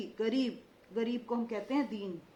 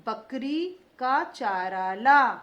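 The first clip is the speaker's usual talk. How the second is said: slow, louder, every syllable clear; slow dictation; 7 dB louder, much slower than usual. Shown as a demonstration. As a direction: dictate it slowly to the class. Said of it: "देखते जाइए मैं कैसे उच्चारण कर रही हूँ".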